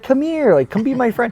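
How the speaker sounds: high-pitched pleading call to a pet; coaxing the dog. Retold or imitated. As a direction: coax a wary dog in a high voice. Retold coaxing of a wary dog.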